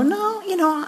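soft, high brush-off; dismissive. Acted. Preacher mimicking people refusing God's help, dismissive.

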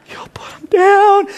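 high, squeaky, choked-up mock crying; mock tearing up. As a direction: squeeze it out slowly as if fighting tears. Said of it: "you were tearing up a little bit".

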